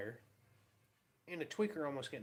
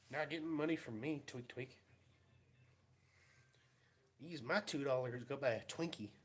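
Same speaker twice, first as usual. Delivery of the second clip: ordinary delivery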